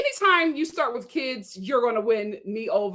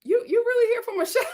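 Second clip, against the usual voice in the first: laughing; 10 semitones higher, much faster than usual. Retold or imitated. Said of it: "but I was just like".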